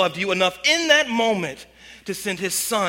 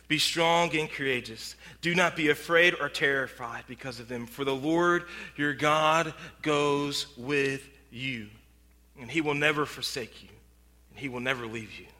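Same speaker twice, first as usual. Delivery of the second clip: soft scripture reading; 6 semitones lower, 6 dB quieter than usual. Real, not acted. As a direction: read it as a gentle promise. Quoting scripture as comfort.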